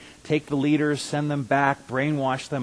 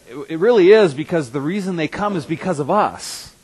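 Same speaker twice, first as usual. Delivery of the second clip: a sudden burst, much louder and higher; excited exclamation; 6 semitones higher, 10 dB louder than usual. Real, not acted. Preacher to his church about visiting friends, an excited loud burst. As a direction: throw it out to the congregation with delight.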